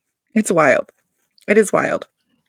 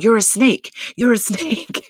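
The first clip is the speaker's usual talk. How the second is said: mock yell; 5 semitones higher than usual. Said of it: "yells out".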